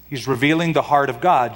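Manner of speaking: ordinary talk, nothing marked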